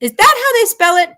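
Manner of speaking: high, fast disbelief; incredulous. Real, not acted. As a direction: ask in sheer disbelief. Baffled by the spelling.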